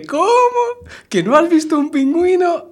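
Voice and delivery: high, loud, incredulous squeal; shocked disbelief. Acted. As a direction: gasp it out as if it can't be true. Shocked policeman in the joke.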